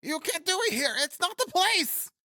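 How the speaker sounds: very high-pitched panicked protest; alarmed cry. A narrator's reading. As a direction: cry out in a squeaky put-on voice. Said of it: "cried Achilles, rousing himself".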